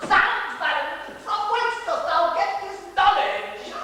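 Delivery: high, slow, hammed-up Elizabethan actor; hammed-up Shakespeare. Dresser hamming up a stage line for sir, high theatrical voice. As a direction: ham the old stage line. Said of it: "dresser quotes Shakespeare mockingly".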